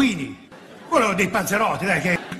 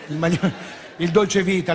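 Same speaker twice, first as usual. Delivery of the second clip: ordinary delivery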